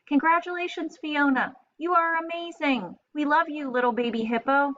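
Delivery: put-on delighted fans cheering the baby hippo; gushing fan mail. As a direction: gush like a fan writing to a star. Fans' letter voiced.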